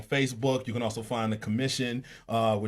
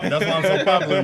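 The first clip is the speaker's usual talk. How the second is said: fast, louder, higher wrestler brag; wrestling promo; 6 semitones higher, 8 dB louder, faster than usual. Acted. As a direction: hype it like a pro wrestler. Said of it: "This is my wrestling voice".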